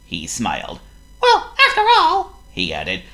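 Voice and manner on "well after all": very high, loud laughing mouse; a tiny mouse giggling at his idea; squeaky laughing mouse; Squeak the mouse. Acted. Voices Squeak.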